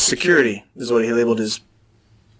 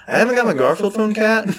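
raised, whiny customer complaint; higher mock complaining customer; mock disgruntled customer; 8 semitones higher than usual. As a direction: whine like a fed-up caller.

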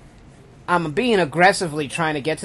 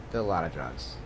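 lower, softer repeat; quiet confession; 11 semitones lower, 12 dB quieter than usual. Real, not acted. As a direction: mutter it again, guiltily. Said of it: "repeated quieter, lower".

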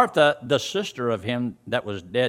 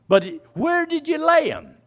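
raised, high acted question; high-pitched questioning; 13 semitones higher than usual. Preacher retelling the question at the tomb, high-pitched.

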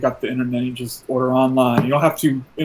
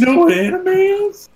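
put-on high, loud children's presenter voice; kids' TV host; 5 dB louder than usual. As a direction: hype it up like a kids' show host.

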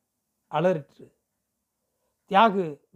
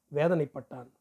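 plain reading voice

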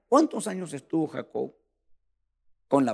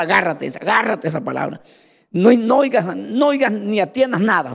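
fiery preaching; 3 semitones higher, 7 dB louder than usual. Acted. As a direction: preach it out to the whole church. Preacher at full force.

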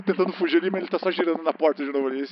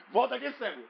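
laughing call; 8 semitones higher, 9 dB quieter than usual. Acted. Calling after escaping blind man.

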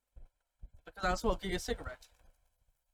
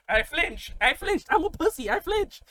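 climbing higher and louder with each phrase; mock panicked confession; 10 semitones higher, 5 dB louder than usual. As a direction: blurt it out, pitch rocketing in mock panic. Mocking his own frightened flinch.

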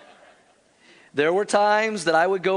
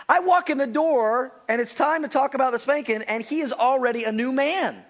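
ordinary talk, nothing marked

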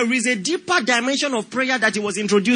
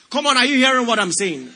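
ordinary talk, nothing marked

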